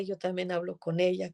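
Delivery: usual speaking voice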